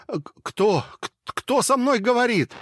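high, stuttering frightened question; high scared stammer; stammering fear. A narrator's reading. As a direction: stumble over the words in fear. Stammered panic.

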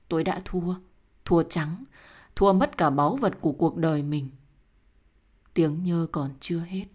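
ordinary delivery, nothing marked

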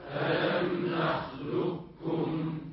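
distant group chant; faint repeat; class echoing from afar. Real, not acted. Students chanting back ألم نخلقكم. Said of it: "فيقولون بكاف مشددة".

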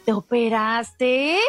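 slow, drawn-out question laced with venom; venomous question. Shown as a demonstration. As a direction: ask it with poison in it. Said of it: "hay un poquito de venenito". (pregunta lenta y venenosa)

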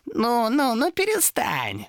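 high, drawn-out demon voice; mock-soothing demon. Demon tells sobbing Томас to stop, mock-soothing. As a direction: shush him in a high creature voice.

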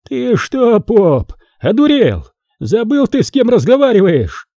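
high, enraged envoy's shout; furious yell. Acted. Enraged envoy yelling at the metropolitan, furious shout. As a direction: spit the words at the priest. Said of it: "заорал".

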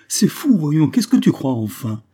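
loud, exasperated protest; crying out. Acted. Accused wife protesting her husband's suspicion, crying out. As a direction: cry out high, then come down in pitch. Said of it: "cria Claire".

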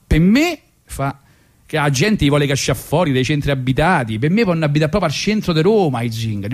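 ordinary talk, nothing marked

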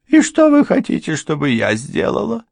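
high, loud, giving-in question; resigned question. A narrator's reading. Housekeeper giving in after a pause, high and resigned. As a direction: ask what they want, beaten. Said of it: "спросила экономка после затянувшейся паузы".

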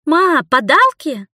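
put-on high, slow expectant child voice; hopeful little girl asking; eager little girl. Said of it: "спросила плутовка выжидающе".

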